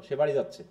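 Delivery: low tune falling through যাচ্ছে; statement intonation. Shown as a demonstration. Teacher testing students for a question, statement fall on যাচ্ছে. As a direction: keep any question out of যাচ্ছে. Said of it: "কি কোনো প্রশ্ন আছে?".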